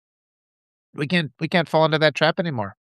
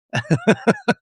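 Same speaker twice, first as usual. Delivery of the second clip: short amused laugh; 7 semitones higher than usual. Real, not acted. Laughs at mouthy writer remark.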